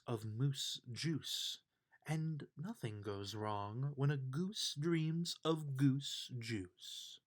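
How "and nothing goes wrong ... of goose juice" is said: ordinary delivery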